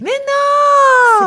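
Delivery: very high, drawn-out cry; real loud protest. Real, not acted. Consultant denies her catchphrases are planned, high shouted protest. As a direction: squeal it in loud, amused indignation.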